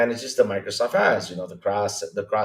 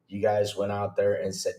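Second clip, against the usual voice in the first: normal talking voice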